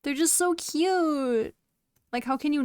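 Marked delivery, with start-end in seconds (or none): 0.0-1.5 gushing. Real, not acted. Gushing over foxes.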